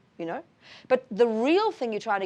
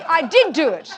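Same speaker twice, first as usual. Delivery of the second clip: "did" punched high and loud; emphatic admission; 6 semitones higher, 9 dB louder than usual. Acted. Teacher modelling owning up without guilt, "did" stressed high and loud. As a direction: own up loudly, hitting "did" hard. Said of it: "But no, you say".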